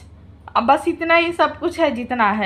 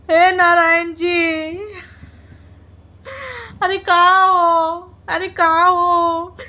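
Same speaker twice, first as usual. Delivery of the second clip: mock wailing. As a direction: wail to the gods in pretend despair. Mock lament over party outfits.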